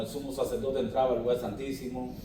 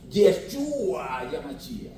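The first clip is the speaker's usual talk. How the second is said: mocking; 4 semitones higher, much slower than usual. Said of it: "hay que decir el nombre".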